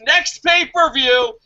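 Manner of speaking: hammed-up shouted command; mock boss shout. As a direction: yell it like a demanding boss. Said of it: "And Vince is like".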